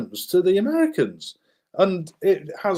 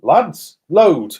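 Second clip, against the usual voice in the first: louder command to load, retold; barked order; 6 dB louder than usual. Colonel ordering his men to load, loud mock command. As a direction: call the men to arms, mock bark. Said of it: "Turns and he goes".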